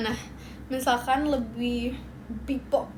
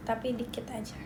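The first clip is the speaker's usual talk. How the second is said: unvoiced whispered aside; 4 semitones lower, 10 dB quieter, faster than usual. Real, not acted. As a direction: drop to a secret whisper.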